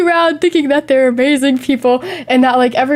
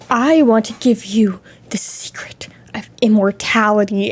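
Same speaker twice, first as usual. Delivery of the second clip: low breathy whisper of a creepy stranger; hushed creepy stranger; 4 semitones lower than usual. Retold or imitated. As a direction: whisper it close, like a creep sharing a secret. Creepy stranger voiced in story.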